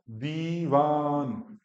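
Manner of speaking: "दीवान" with dee and vaa both stretched; long vowels. Shown as a demonstration. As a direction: draw out both syllables of "दीवान". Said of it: "दो second का time देना है".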